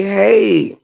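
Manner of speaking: normal talking voice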